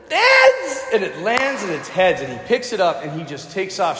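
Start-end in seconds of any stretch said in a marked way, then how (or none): 0.1-0.8 put-on high, louder little-kid call; a toddler shouting his pick; tiny boy calling. Little boy calling the toss, high kid shout. Three-year-old calls heads.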